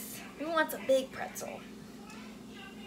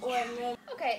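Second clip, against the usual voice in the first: usual speaking voice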